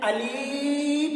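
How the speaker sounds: अलीफ with the second vowel drawn far out; wrong long ee. Shown as a demonstration. Teacher showing what some people say, अलीफ with overlong ee. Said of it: "कुछ लोग इसको कह देते हैं".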